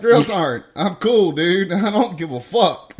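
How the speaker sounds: cocky, careless answer to the sergeant; cocky reply. Retold or imitated. Recruit mouthing off to drunk sergeant, cocky. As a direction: brush him off, loud and breezy. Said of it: "I was like".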